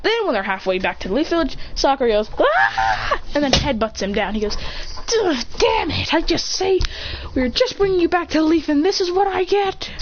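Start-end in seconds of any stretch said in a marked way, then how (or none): 2.4-3.2 short, very high mock battle cry; sudden high "ah" yell; high attack yell. Sakura yelling before a headbutt, high cry. 5.1-9.9 higher, put-upon protest; hammed-up grumbling in a raised pitch; exasperated complaint. Headbutted character complains.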